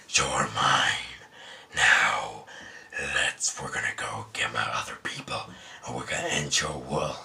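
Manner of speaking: deep, whispered alien symbiote voice; low hissing monster whisper; Venom voice. Retold or imitated. Reviewer voicing Venom to Tom, low monster whisper.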